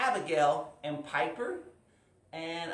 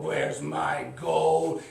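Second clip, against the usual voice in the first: loud, slow, drawn-out demand; angry dragon; 5 dB louder, much slower than usual. Acted. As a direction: demand it slowly as a cross dragon. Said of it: "he was angry".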